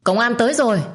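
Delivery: sudden shouted warning. A narrator's reading. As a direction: yell it out in alarm. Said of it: "có tiếng hô lớn".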